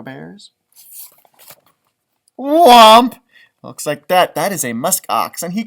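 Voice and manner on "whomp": booming shouted whomp; big cartoon whomp; loud whomp sound. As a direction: land the whomp hard like a thud. Sound effect word in story.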